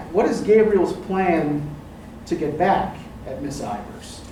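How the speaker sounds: normal talking voice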